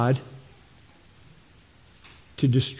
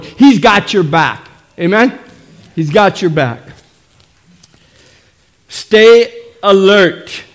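fired-up preaching; 4 semitones higher, 5 dB louder than usual. Real, not acted. Pastor rallying the church for battle, fired-up preaching. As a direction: preach it out to the congregation.

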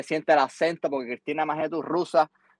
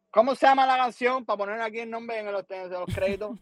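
higher, asking the song title in a put-on woman's voice; imitating radio woman; 7 semitones higher than usual. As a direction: play the radio host prying for the title.

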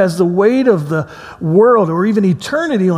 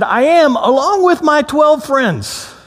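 anxious bluff; 9 semitones higher, slower than usual. Retold or imitated. Bluffing to gate agent.

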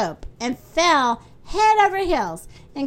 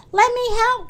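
higher-pitched eager offer of help; pitched-up storybook cry; 8 semitones higher, 4 dB louder than usual. A narrator's reading. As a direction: call out brightly, rushing to help. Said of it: "cried Little Miss Helpful".

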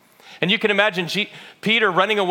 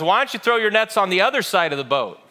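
raised, higher voice, mildly calling out; retold calling out; 5 semitones higher, 4 dB louder than usual. Man on shore to the fishermen, mildly called out. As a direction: call it out without a full shout. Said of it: "And so he yells out".

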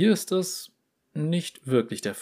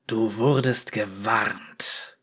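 growling; 2 semitones lower, much slower than usual. Acted. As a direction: growl it slowly like a beast. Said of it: "knurrte ihm eine Stimme ins Ohr".

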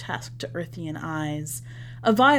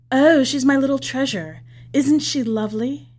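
higher, louder, doting proud boast; proud envoy; 5 semitones higher, 7 dB louder than usual. Acted. Envoy gushing over his interpreter to the businessman, proud and louder. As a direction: show off your little treasure. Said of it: "obviously proud of Sam Sa'i".

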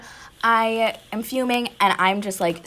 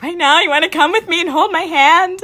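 higher, louder, panicky disbelief; alarmed surprise; 10 semitones higher, 9 dB louder than usual. Retold or imitated. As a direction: balk at being sent right now. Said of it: "And I'm like".

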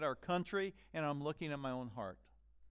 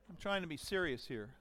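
ordinary delivery

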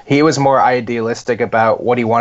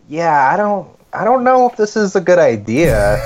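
higher-pitched imitation of a hesitant dad; doubtful dad; 5 semitones higher than usual. Son imitating his dad doubting the invasion, hesitant. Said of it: "my dad was the one going like".

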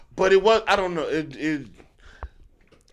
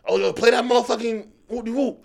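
higher, excited mock request; eager request; 9 semitones higher, 4 dB louder than usual. Imagined fan demanding a great song, excited. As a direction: call out for a favorite track, hyped.

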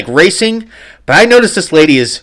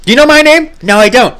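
mock shout; 11 semitones higher, 5 dB louder than usual. Retold showdown with lady.

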